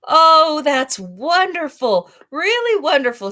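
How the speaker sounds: high, loud, slow gushing; overjoyed lion admiring its braided mane; delighted lion. Acted. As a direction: gush with joy over the braid. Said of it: "he was all smiles".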